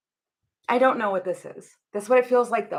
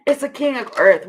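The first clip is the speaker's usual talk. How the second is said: louder restart; 4 semitones higher, 8 dB louder than usual. Real, not acted. Restarts the card after a laughing fit.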